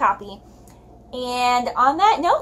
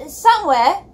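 higher, louder half-helpless call; helpless shout; 6 semitones higher, 5 dB louder than usual. Acted. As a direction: shout it while being jostled away. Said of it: "she shouted, a little helplessly".

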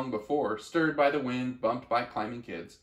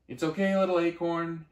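raised, higher call across a distance; mother calling to her acorn as the truck drives off; 5 semitones higher, 4 dB louder than usual. Acted. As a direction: call after him as he is carried away. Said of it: "his mom called out".

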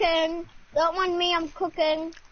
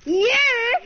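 louder, higher mock insistence; insistent yes; 6 semitones higher, 5 dB louder than usual. Real, not acted. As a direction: win the silly argument. Yes-nah shouting match.

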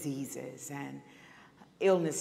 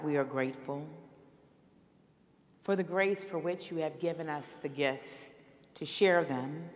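pastoral prayer. Acted. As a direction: pray it over the congregation, phrase by phrase. Pastor leads closing prayer.

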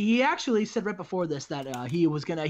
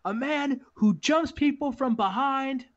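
higher, emphatic microphone speech; wrestler's promo; 5 semitones higher than usual. Acted. Raven endorsing Mike Awesome on the microphone, emphatic promo. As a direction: declare it to the crowd in punched phrases.

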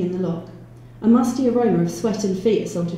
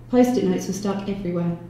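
plain reading voice

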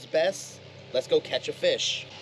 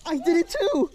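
excited; 14 semitones higher than usual. Real, not acted. Excited at catching fish.

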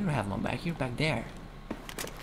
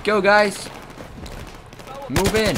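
high-pitched squad command; calling orders; 9 semitones higher, slower than usual. Pretend. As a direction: call the team forward, voice raised high.

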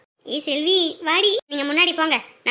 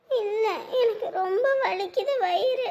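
slow, higher, tearful complaint through sobs; crying in pain; 5 semitones higher, slower than usual. Acted. Girl crying to the women about her stomach ache, tearful. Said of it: "அதுக்கு எதுக்குடி அழுவுற?".

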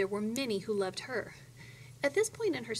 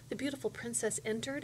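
plain reading voice

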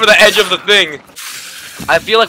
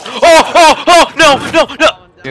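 being chased; 7 semitones higher, slower than usual. Real, not acted. Panicking in zombie game.

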